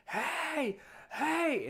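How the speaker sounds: high-pitched mock holler; imitated distant screaming. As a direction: imitate someone yelling across a field. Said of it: "starts screaming at us".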